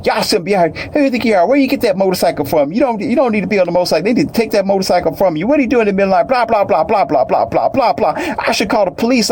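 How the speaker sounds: high-pitched hammed-up Karen rant; mocking Karen voice. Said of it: "talking all kind of junk".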